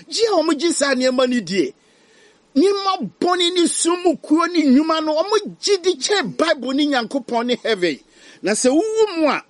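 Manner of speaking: high, loud urgent preaching; fiery pulpit warning; impassioned preaching. Real, not acted. Preaches at full force.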